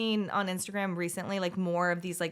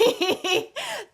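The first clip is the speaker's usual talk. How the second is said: high, loud giggle; laugh; 6 dB louder than usual. Real, not acted. Laughing at being rescued from spiders, high giggle.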